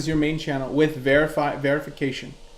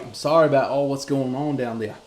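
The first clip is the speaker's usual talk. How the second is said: light mock Australian accent; put-on Australian accent. To Mike in Australia, in a mock Australian accent. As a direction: sympathize in a playful Australian accent. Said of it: "You like my accent?".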